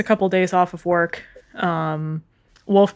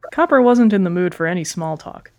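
plain reading voice

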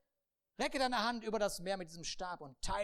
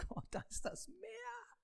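much higher, slow whining voice; whiny Moses; 8 dB quieter, slower than usual. Acted. Moses whines to God about the sea. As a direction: plead in a whimper.

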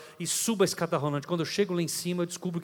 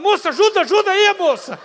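loud, high frantic call to the attendant; calling for help; 11 semitones higher, 9 dB louder than usual. Retold or imitated. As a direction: shout for the girl to help, urgent. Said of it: "eu falei". (chamando alto e aflito a moça)

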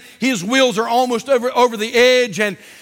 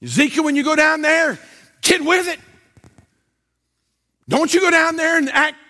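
raised-pitch commanding preaching; forceful charge; 4 semitones higher than usual. Acted. God sending Ezekiel to preach, forceful command. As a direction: order the prophet to get going.

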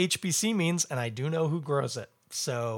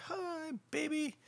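tender greeting; 13 semitones higher, 9 dB quieter, slower than usual. Real, not acted. Waving at his wife.

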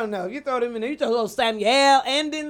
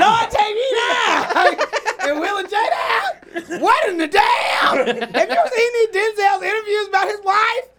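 exasperated outcry; 9 semitones higher than usual. Real, not acted. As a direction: wail it in over-the-top disbelief. Mock outrage at a couples ranking.

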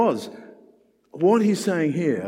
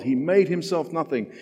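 ordinary delivery

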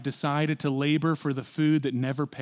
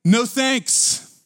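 defiant refusal; 8 semitones higher, 5 dB louder than usual. Retold or imitated. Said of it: "you know what we say".

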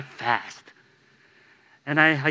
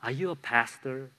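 softer, quiet, turned inward; sincere, hushed self-question; 7 dB quieter than usual. Real, not acted. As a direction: ask it of yourself, softly. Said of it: "ring so true in my heart".

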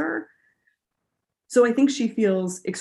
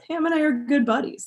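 higher, playful wink in the voice; winky, smiley; 8 semitones higher than usual. Shown as a demonstration. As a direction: say it with a knowing smile. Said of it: "a little smiley or a little winky".

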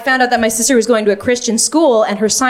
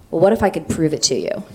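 lower, serious challenge; 4 semitones lower, faster than usual. Retold or imitated. Sister offering to prove God exists, very serious. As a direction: pose it as an earnest challenge. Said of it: "she looked very serious and said".

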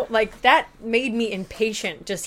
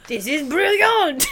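slow, higher-pitched fake French accent; bad French accent; 5 semitones higher, slower than usual. Imitating movie characters praising the food, bad French accent. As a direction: ham up a terrible French accent. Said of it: "everyone had such bad accents".

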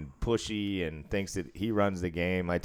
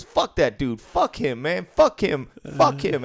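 a hammed-up angry outburst, much higher; mock angry rant; 5 semitones higher, 4 dB louder than usual. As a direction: spit out the repeated curses like a sore gambler. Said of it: "He goes".

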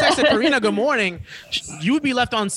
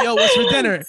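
mock high voice. Mocking the triple text.

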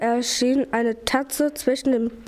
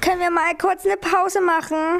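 higher-pitched begging request; pleading; 6 semitones higher than usual. A narrator's reading. Pupsi begs the others for a short break, pleading. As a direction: wheedle like a tired little one. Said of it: "fragte Pupsi bettelnd".

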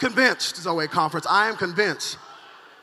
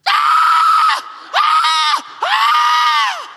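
loud, high imitated baby wail; newborn's cry; 9 dB louder, much slower than usual. Shown as a demonstration. Preacher showing how every baby comes into the earth, wailing. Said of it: "comes into the earth just like this".

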